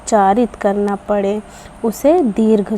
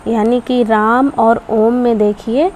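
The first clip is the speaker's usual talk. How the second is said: ordinary talk, nothing marked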